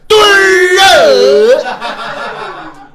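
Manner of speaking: hammed-up Tianjin peddler cry; Tianjin vendor yell. Shown as a demonstration. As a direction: bellow a single word like a Tianjin hawker. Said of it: "您这要到了天津卫".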